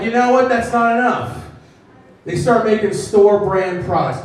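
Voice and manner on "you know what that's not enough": greedy stores. Retold or imitated. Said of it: "They were like".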